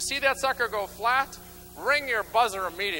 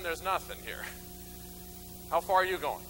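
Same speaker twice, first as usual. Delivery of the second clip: ordinary talk, nothing marked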